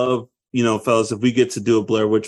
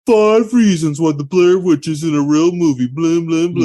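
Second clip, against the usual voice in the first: mock video-host voice, pitched up; TikTok presenter; 4 semitones higher, 4 dB louder than usual. Debunker pitching a TikTok video, mock presenter voice. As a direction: parody an online listicle host, a bit louder and higher. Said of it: "people on TikTok like".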